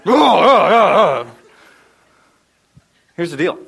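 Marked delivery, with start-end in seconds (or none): 0.1-1.3 loud, drawn-out groans of effort; hammed-up strained groans. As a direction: groan loudly as if dumping heavy weights. Acting out dropping heavy weights.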